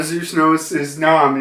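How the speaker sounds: ordinary talk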